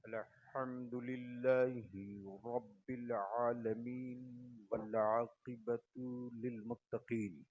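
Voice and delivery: measured, chanted recitation with drawn-out syllables; Arabic sermon invocation. Real, not acted. Preacher opening his sermon with Arabic praise, intoned. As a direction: intone it steadily, pausing between phrases.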